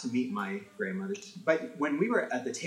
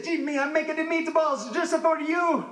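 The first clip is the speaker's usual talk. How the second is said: high put-on Italian mama voice, a bit louder; mom voice; 5 dB louder than usual. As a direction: play his doting Italian mother, pitched way up. Mom voiced in standup story.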